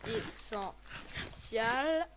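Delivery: very slow, drawn-out dictation of ESSENTIAL; syllable by syllable. Real, not acted. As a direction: break ESSENTIAL into separate, stretched syllables. Spelling it out while typing.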